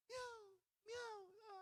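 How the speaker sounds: put-on baby meow. Baby imitates a cat.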